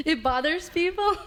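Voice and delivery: real giggly incredulity; amused disbelief. Real, not acted. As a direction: ask it through a laugh, rising at the end. Amused disbelief, laughing with co-hosts.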